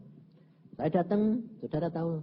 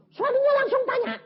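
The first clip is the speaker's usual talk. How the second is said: high, loud mock shout; husband bursting out in anger; 10 dB louder than usual. Preacher retelling the furious husband, loud high shout.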